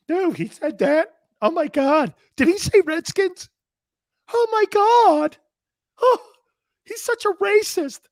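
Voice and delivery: very high, loud squeaky whine of outrage; hammed-up falsetto shock; mock outraged snowflake. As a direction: squeal it like a scandalized snowflake caricature. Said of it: "I've been talking to snowflakes on the West Coast".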